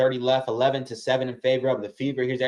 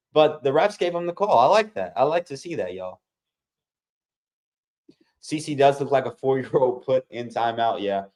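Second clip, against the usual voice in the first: usual speaking voice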